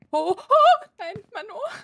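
high, loud panicked yelps; real rising panic. Real, not acted. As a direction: yelp as you start to slip. Falls nearly off in game.

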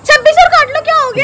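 loud, high, heated comeback; exasperated retort. Real, not acted. Woman talking a man out of dying, exasperated. Retorts to his despairing complaint.

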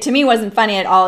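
ordinary talk

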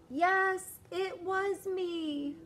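put-on high, slow kid voice; confessing kid. David admitting it to mom, high and slow. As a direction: own up at last.

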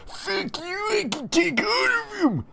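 very high cracked yell; screaming back. Acted. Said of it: "Eric screams back".

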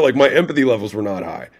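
ordinary delivery

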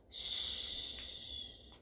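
unvoiced hush; shushing. Real, not acted. Teacher to children at story end, soft shush. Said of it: "Ya está en la cama dormido".

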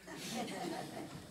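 brief chuckle at own joke; a quick real laugh; short laugh. Real, not acted. Joke about favorite great-grandson.

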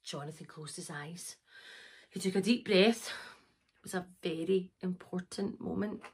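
low, very soft narration; hushed suspense. A narrator's reading. Narrator before the pony's important magic attempt, hushed. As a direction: drop right down, solemn and hushed.

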